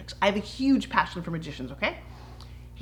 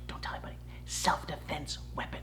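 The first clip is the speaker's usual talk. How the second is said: conspiratorial whisper; 5 semitones lower, 13 dB quieter than usual. Acted. Host letting viewers in on a secret, whispered.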